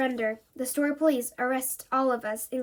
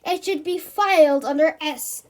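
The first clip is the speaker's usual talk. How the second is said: loud, grumbling monster voice; cross monster; 3 semitones higher, 8 dB louder than usual. Acted. Monster complaining about misfiled sand. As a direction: snarl it like a fussy monster.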